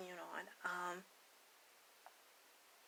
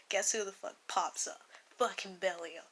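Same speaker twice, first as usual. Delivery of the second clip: ordinary talk, nothing marked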